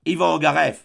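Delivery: loud repeated cry of the name. A narrator's reading. Nadia crying the name again while cornered, loud. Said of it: "cria encore Nadia".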